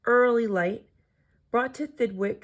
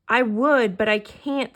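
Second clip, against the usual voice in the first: plain reading voice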